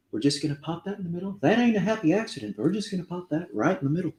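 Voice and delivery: fast, lightly voiced Bob Ross; a hint of Bob Ross, rushed and light; Bob Ross impression. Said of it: "my horrible Bob Ross impression".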